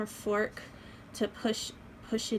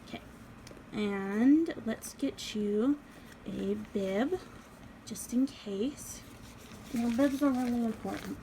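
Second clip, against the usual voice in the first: ordinary delivery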